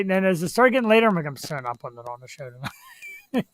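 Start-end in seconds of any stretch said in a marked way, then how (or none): 1.3-3.5 much softer, lower mumbled retreat; sheepish backing out. Retold or imitated. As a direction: quietly take it all back. Retold change of mind, embarrassed.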